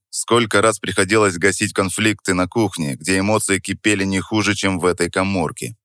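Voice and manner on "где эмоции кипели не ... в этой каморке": plain reading voice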